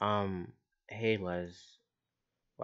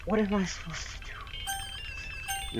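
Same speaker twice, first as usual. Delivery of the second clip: much higher, desperate panic; high panicked plea; 12 semitones higher than usual. Real, not acted. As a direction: squeal it out helplessly. Forced to choose between characters.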